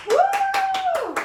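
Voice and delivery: high, loud cheer of triumph; excited whoop. Real, not acted. Dance teacher whooping at the end of the routine, excited. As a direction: let out a big woo at the finish.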